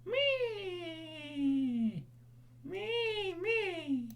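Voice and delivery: very high, soft creature squeak; small sad squeak; quiet pet squeak. Pretend.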